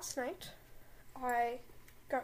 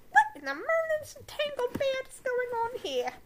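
much higher, louder shriek of a stern witch; McGonagall voice; 12 semitones higher, 8 dB louder than usual. Acted. McGonagall catches Snape and Hermione, high loud shriek. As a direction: shriek like a scandalised old headmistress. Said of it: "Should I do a McGonagall voice?".

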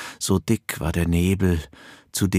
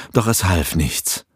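ordinary delivery, nothing marked

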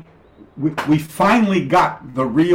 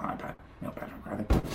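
whispered aside; 3 semitones lower, 11 dB quieter than usual. Acted. Aside muttered under his breath.

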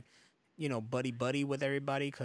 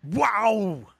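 loud, drawn-out celebrity mimicry; hammed-up, booming impression; Arnold; 7 semitones higher, 10 dB louder than usual. Shown as a demonstration. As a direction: belt out a botched impression, pitched up. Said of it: "that was Arnold with".

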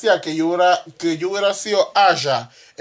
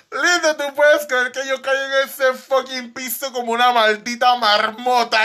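riendo; 8 semitones higher than usual. Real, not acted. Cuenta su caída riendo.

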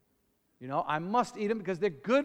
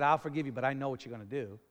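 mock grudging; 7 semitones lower, 4 dB quieter, much faster than usual. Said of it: "it wasn't like he said".

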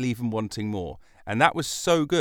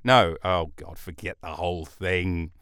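ordinary delivery

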